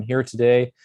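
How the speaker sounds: ordinary talk, nothing marked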